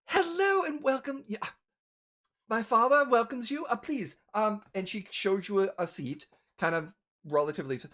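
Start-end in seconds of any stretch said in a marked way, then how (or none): 0.1-4.2 high-pitched polite hostess greeting; female server. Acted. Daughter welcoming guests for her father, polite and high. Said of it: "female comes up, and she kinda says".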